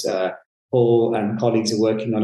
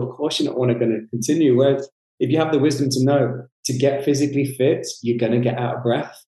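ordinary delivery

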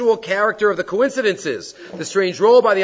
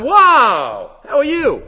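high, loud mock delight; surprised greeting; 9 semitones higher, 9 dB louder, much slower than usual. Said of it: "when you see somebody".

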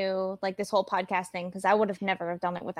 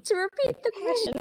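laughing; 12 semitones higher than usual. Real, not acted. Still giggly after the joke.

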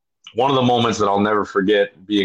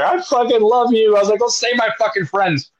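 much higher gushing gratitude; overjoyed; 13 semitones higher than usual. Retold or imitated. Rower hugging a Filipino crewman, high gushing joy. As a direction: gush with relief.

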